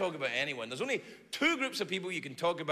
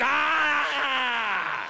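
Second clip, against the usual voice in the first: 1 hockey rage shout; 6 semitones higher, 6 dB louder than usual. Acted. Hockey player losing it.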